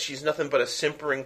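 ordinary delivery

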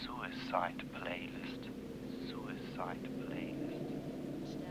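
drawn-out whispered refrain, dying away. Acted. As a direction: whisper it slowly like a ghost.

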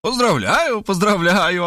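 high, joyful congratulations; gleeful high exclamation; delighted. A narrator's reading. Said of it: "обрадовалась та".

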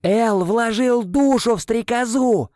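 slow high bellow; yelling. A narrator's reading. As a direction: yell each word out slowly. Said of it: "заорал я". (громкий протяжный ор)

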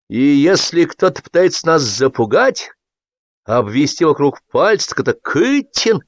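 high, emphatic build to the name; slow-starting charge, climbing sharply; heated accusation. A narrator's reading. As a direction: hurl the accusation at him. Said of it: "Выплеснул он в лицо Кытину".

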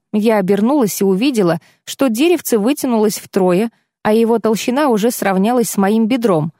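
ordinary delivery, nothing marked

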